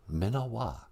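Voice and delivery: ordinary delivery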